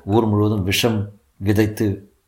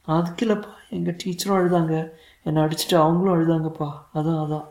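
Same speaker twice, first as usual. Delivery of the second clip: put-on high child voice, lightly tearful; a touch of sobbing in a little boy's voice; tearful small boy; 8 semitones higher than usual. A narrator's reading. Son explaining why he cried, understated tearful child voice. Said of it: "என் பிள்ளை விக்கி விக்கி அழுதான்".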